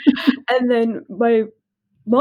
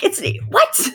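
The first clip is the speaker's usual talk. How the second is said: mom freaking out. Retold or imitated. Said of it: "And she freaks out".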